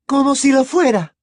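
high, loud, fierce retort. A narrator's reading. Mother answering the godfather about the boy, fierce. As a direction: fire it back fiercely, pitched high. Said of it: "repuso la señora Weasley con fiereza".

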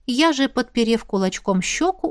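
usual speaking voice

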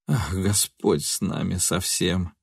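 slow, soft lament; sorrowful reply. A narrator's reading. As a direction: sigh it out in grief. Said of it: "горестно отозвался Нильс".

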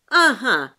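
loud, triumphant aha; gleeful exclamation. A narrator's reading. Тётка catches them out, joyfully exclaiming. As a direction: pounce on it with joy. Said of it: "радостно воскликнула тётка".

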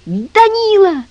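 put-on high, drawn-out boy's scream for rescue; shrill-pitched calling for help; wailing yell. A narrator's reading. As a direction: yell it high, stretching the name. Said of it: "завопил Митя".

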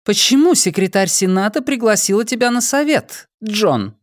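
high, cool woman's voice; Dayana's voice. Acted. Dayana questioning the drugged prisoner, high and cold. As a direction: play the cold interrogator lady.

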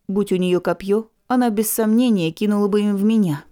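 ordinary delivery, nothing marked

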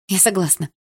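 usual speaking voice

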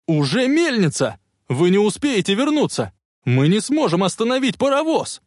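high, alarmed shouting; frantic yell. A narrator's reading. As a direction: yell an urgent warning. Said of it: "истошно завопил Макфлай". (истошные крики предупреждения)

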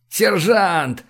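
high, pleading cry. A narrator's reading. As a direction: beg the sergeant urgently. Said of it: "взмолился док".

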